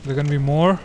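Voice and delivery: normal talking voice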